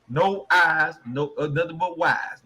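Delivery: normal talking voice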